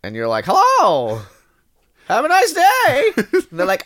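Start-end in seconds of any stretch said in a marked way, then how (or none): 0.5-3.1 high, loud, chirpy hello; cheerful greeting. Pretend. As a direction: belt out a sunny catchphrase. Acted cheerful catchphrase greeting.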